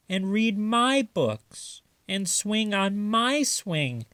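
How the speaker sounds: put-on high, childlike begging; a small boy begging mother to stay home; pleading little raccoon. A narrator's reading. Chester begging his mother to stay home, high and whiny.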